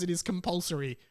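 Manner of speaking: ordinary talk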